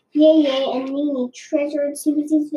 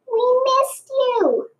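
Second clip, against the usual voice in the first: snake parents' voice; 11 semitones higher, slower than usual. A narrator's reading. Snake parents greet their daughter.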